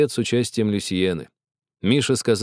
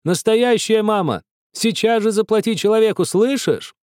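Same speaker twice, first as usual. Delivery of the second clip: crowd shouting; 11 semitones higher than usual. A narrator's reading. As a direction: yell down at him from the windows. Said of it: "десятки голосов закричали".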